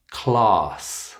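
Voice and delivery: "Class" said clahss; long ah. Shown as a demonstration. Teacher models word for practice, long ah in "Class". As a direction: stretch "Class" with an open ah. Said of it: "It's the long ah sound".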